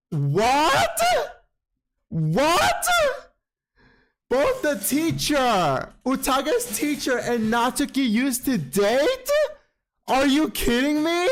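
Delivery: high, rising, incredulous outburst; shocked disbelief. Retold or imitated. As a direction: react to a scandalous reveal, pitched high. Acts out shock at the reveal.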